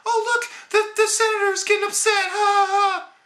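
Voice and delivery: high-pitched sneering voice with fake laughs; mock her smirk with a high giggle; mocking giggly girl. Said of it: "starts smirking and giggling".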